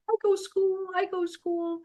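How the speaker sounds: put-on high little-girl voice, pleading to go to school; a small child begging to go too; tiny toddler insisting; toddler daughter. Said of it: "was like".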